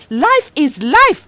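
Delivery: slow, high and loud; mother shutting down the argument; stubborn retort. Acted. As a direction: lay it down as final, slowly. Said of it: "she said".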